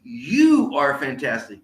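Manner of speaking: long swooping YOU, slow cheer; enthusiastic rally call. Shown as a demonstration. Speaker models praise for a friend, enthusiastic cheer. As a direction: draw out the praise with punch. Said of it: "get the power of those words".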